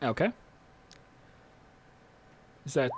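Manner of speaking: usual speaking voice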